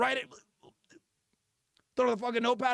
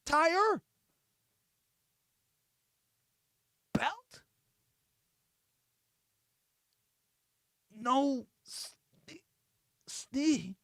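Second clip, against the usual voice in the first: halting syllable-by-syllable reading; barely literate reader, long gaps; sounding out words; much slower than usual. Acted. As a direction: struggle through each word, guessing with a rising tone. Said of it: "You sounding out the words".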